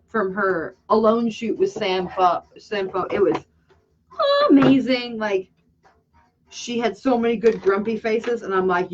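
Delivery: ordinary delivery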